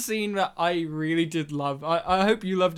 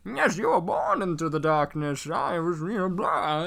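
Bane impersonation; 4 semitones lower than usual. Pretend. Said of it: "I'm just like".

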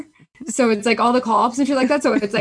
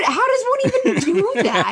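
high, incredulous squeak with laughter; baffled; 14 semitones higher than usual. Retold or imitated. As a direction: act out being totally clueless. Retold bafflement at podcast idea.

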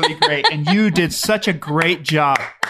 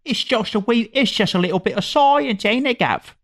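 put-on Coventry English accent, a bit higher; 5 semitones higher than usual. Friend imitates Tandy from Coventry in English accent. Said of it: "sound a little more something like this".

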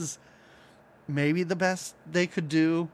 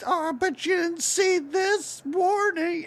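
much higher, slow, taunting cartoon ghost; 12 semitones higher than usual. Retelling the ghosts teasing Pac-Man, high slow mock voice. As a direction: draw it out up high like a smug ghost. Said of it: "the ghosts are like".

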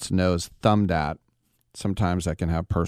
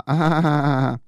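higher, staccato taunting laugh; mock jeering laugh; 8 semitones higher than usual. Hostel kids mocking the Americans behind them, put-on jeering laugh. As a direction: imitate a gang's mocking ha-ha.

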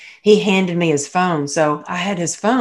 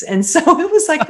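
laughing; 12 semitones higher, 4 dB louder than usual. Real, not acted. Laughing about the mooning.

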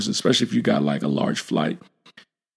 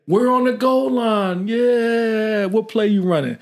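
higher-pitched mock team chorus with drawn-out vowels; call-and-response imitation, pitched up; imitated group reply; 7 semitones higher than usual. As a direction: lightly act out a crew answering in unison. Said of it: "in unison, they're gonna say".

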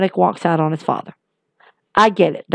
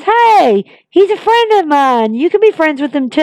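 imitating Corbin; 6 semitones higher than usual. Retelling Corbin vouching for Logan Paul, mock-friendly. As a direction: sell it like a cheesy buddy. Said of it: "Corbin claims".

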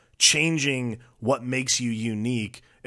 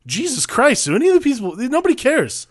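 high-pitched, louder-edged mock exasperation; exasperated viewer reaction; 11 semitones higher, 3 dB louder than usual. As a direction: act out an appalled viewer, pitched way up.

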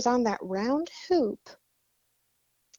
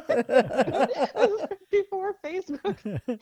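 laughing; 8 semitones higher, 3 dB louder, slower than usual. Real, not acted. Laughing about the old toilet story.

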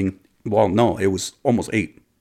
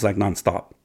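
ordinary delivery, nothing marked

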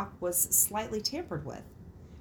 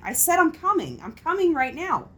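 put-on higher, insistent old lady; grandma voice; 7 semitones higher, 5 dB louder than usual. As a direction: repeat it like a stubborn grandma. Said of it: "she just keeps saying the same thing".

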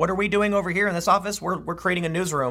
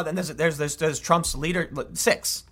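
usual speaking voice

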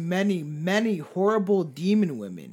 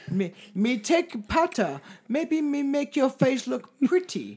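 higher, broken-English mock Japanese woman; bad Japanese accent; 6 semitones higher than usual. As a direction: overdo a clumsy Japanese accent. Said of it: "You sound like Simple Jack".

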